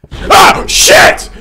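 very high, very loud shriek; startled scream. Real, not acted. Player hit by another jump scare, startled scream. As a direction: shriek the curse in shock.

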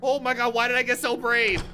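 high, loud panic; real scared, high-pitched exclaiming; panicked outcry. Real, not acted. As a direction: cry out in alarm at your own mistake. Reacting to a jump scare.

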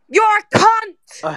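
slow, drawn-out furious insult; real voice-straining outburst; angry shouting. Real, not acted. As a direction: yell it hard, stretching each word. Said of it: "realize you lost your voice".

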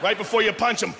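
usual speaking voice

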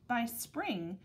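ordinary delivery, nothing marked

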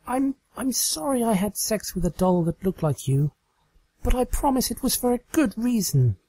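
flustered excuse to her captor; sheepish Trixie. Acted. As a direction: apologize awkwardly, rising with embarrassment. Trixie voiced in the story.

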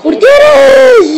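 sudden frustrated yell; shout. Real, not acted. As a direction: shout at the goalkeeper in disbelief. Goalkeeper blunder in game. (urlo frustrato)

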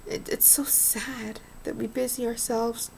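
choked, whispered sadness; barely voiced, near tears; tearful whisper. Real, not acted. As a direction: whisper it as if still crying. After saying she cried today.

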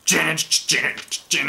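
mock ring-entrance chant; entrance music chant. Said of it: "you hear".

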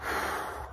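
long unvoiced breath out; deep calming sigh. Real, not acted. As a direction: let the anger out in one breath. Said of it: "take a few deep breaths".

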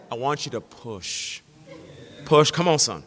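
low, soft, slow coaching push; dad urging. Retold or imitated. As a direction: coax him through the lift, low and steady. Dad urging son on bench.

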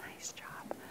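soft breathy praise; whispered praise. Real, not acted. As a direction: murmur it privately.

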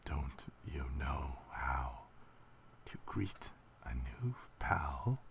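slow, hushed, low creepy whisper. Acted. Mysterious figure greeting a new pal, creepy. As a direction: creep it out slowly under the breath. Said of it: "I make the creepy voice".

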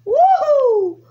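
very high, loud woohoo; exultant whoop. Real, not acted. Player zooming past everyone, very high loud whoop. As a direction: whoop at full volume. Celebrates speed in the game.

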